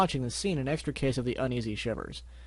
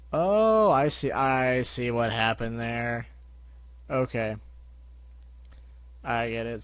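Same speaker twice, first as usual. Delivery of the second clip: realization; 3 semitones lower, slower than usual. Real, not acted. Reader's realization aside.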